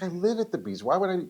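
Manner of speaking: a lightly voiced inner protest; incredulous. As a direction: scoff at the idea as absurd. Incredulous reply to beach imagery.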